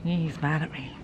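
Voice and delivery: low, soft mock-hurt murmur; quiet aside. Real, not acted. Farmer murmuring about her sulking goat, soft and low. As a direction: say it softly, a bit sheepish.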